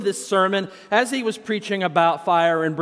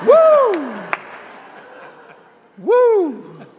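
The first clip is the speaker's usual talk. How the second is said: laughing whoop; 13 semitones higher, 6 dB louder than usual. Real, not acted. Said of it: "preacher whoops after the joke".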